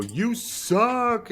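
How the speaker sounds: high taunting game announcer. Retold or imitated. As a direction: squeal it like a cheesy video game taunt. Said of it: "it would go".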